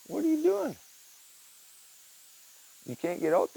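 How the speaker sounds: high pet voice; high sing-song question; talking to the cat. Real, not acted. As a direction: coo at the cat. Calling to stuck cat.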